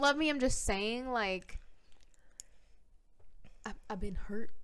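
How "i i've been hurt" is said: mock hurt whisper. Mock wounded confession.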